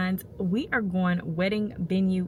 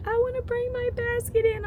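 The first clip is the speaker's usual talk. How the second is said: put-on high whiny child's wail; hammed-up crying kid begging; imitating a crying child. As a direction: mimic her tearful whine, pitched way up. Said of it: "she's screaming and crying".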